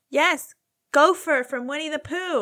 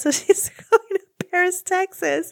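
ordinary talk

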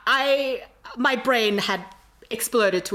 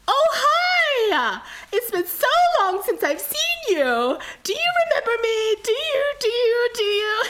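put-on much higher, louder L.A. Valley Girl voice; 6 dB louder than usual. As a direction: gush it like an over-the-top LA Valley Girl. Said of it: "puts on, like, a Valley Girl voice".

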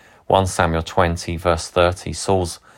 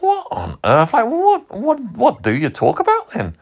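much higher, louder shocked disbelief; incredulous; 3 dB louder than usual. Retold or imitated. Pastor retelling his shock at a friend, incredulous and high. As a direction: squeak out your astonishment.

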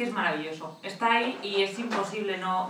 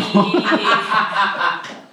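loud burst of laughter; 2 semitones higher, 12 dB louder than usual. Real, not acted. Laughs at his own joke.

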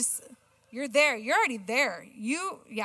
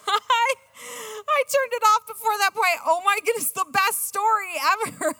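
high and loud, embarrassed disbelief; much higher, louder, half-laughing outburst; self-mocking exclamation; 9 semitones higher, 6 dB louder than usual. Real, not acted. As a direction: blurt it out at your own mistake. Confessing her blunder, laughing at herself.

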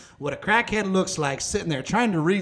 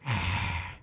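low, soft drawn-out "ah"; low imitated groan; 12 semitones lower, 8 dB quieter than usual. Crackhead approaching on the walk home, low groan.

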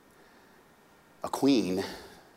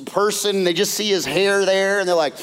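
ordinary delivery

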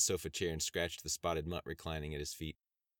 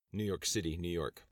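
ordinary delivery

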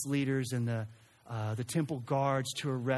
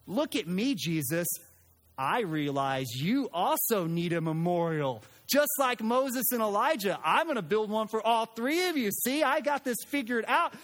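Peter voiced higher and louder, pleased with himself; eager Peter; 8 semitones higher, 4 dB louder than usual. Peter telling Jesus he'll build memorials, eager and sure. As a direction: play the eager disciple who thinks he has it all figured out.

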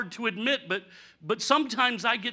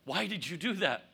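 ordinary talk

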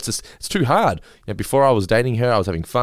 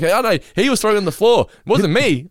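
high-pitched frantic protest of innocence; hammed-up flustered excuse; panicked denial; 11 semitones higher, 4 dB louder than usual. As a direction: plead your innocence in mock panic. Re-enacting panicked blame of child.

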